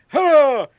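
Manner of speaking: high, loud over-cheery hello; mock greeting. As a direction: greet the flag with fake enthusiasm.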